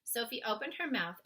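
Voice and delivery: ordinary delivery, nothing marked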